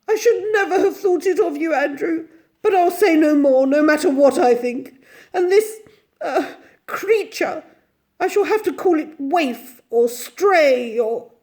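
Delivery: high, loud wounded complaint; overwrought, martyred lady; tearful reproach. A narrator's reading. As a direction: carry on through tears. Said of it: "streaming eyes and went on".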